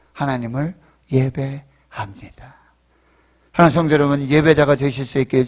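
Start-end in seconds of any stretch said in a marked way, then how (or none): 0.1-2.5 very slow, soft, low devotional close; hushed reverent recital. Acted. Preacher closing recited praise lyrics, hushed and reverent. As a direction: let it sink into a slow hush.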